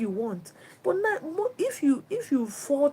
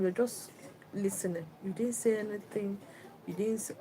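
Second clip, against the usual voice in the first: ordinary delivery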